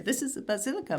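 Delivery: put-on overdone Italian accent on "basilica"; exaggerated Italian accent. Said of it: "not in a good Italian accent, but like".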